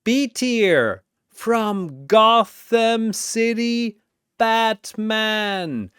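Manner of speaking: boxing announcer calling fighters; arena announcer. Acted. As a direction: hype each fighter like a ring announcer.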